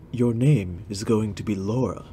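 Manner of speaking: a hint of a gentle elf prince naming her; Legolas voice.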